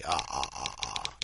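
low, staccato Dracula laugh; soft low count's chuckle; vampire chuckle. Acted. Dracula's laugh before the story.

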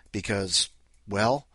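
plain reading voice

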